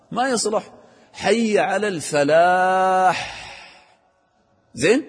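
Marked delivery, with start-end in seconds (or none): 1.1-3.4 slow higher chant, "الفلاح" ending on a full "ح"; long "falaaḥ", final consonant distinct; clear final ḥa. Shown as a demonstration. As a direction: stretch "الفلاح" and finish with a breathed ḥ. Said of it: "تبين الحاء في الفلاح".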